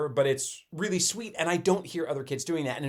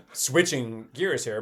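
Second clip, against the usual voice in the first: normal talking voice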